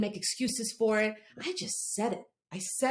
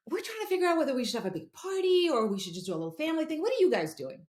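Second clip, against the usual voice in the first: higher, fast chatty mom imitation; gushing mom; 7 semitones higher, faster than usual. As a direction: rattle it off like an excited party-planning mom. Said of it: "And she goes".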